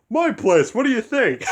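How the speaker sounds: the cat sassing Doctor Strange; sassy cat retort. Retold or imitated. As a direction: toss it back like it's obvious. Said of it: "Doctor Strange asked him the same question".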